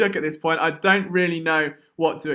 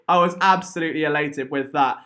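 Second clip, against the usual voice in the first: ordinary talk, nothing marked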